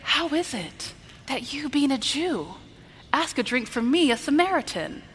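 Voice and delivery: soft, high, wondering question; meek astonishment. Shown as a demonstration. Preacher voicing one possible woman at the well, meekly amazed. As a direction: ask gently, as if humbly amazed. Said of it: "That's not what I think she sounded like".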